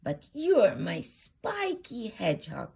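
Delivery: plain reading voice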